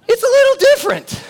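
high, loud and fast, laughing through "little"; laughing exclamation. Real, not acted. Preacher to congregation, laughing the refrain out high. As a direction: burst out with "It's a little different" on a laugh. Laughing punchline after the story.

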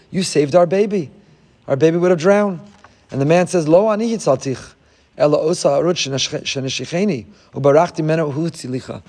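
ordinary talk

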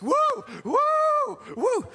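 high, loud "woo" like a train whistle; locomotive whoops. Retold or imitated. Preacher mimicking the fan as a locomotive, whooping. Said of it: "he looks like a locomotive".

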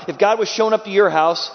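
ordinary talk

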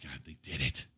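hushed, low, moved almost to tears; tearing up. Real, not acted. Retelling the officer's awe at the escape, low tearful hush. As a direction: say it very softly, choking up. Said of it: "Are you tearing up now?".

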